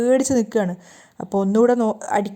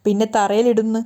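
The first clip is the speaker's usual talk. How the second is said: ordinary delivery, nothing marked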